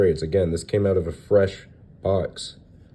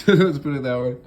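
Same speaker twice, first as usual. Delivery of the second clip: laughing through a higher line; 8 semitones higher, 4 dB louder than usual. Real, not acted. Collector showing off rare sheet, laughing. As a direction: chuckle as you understate it.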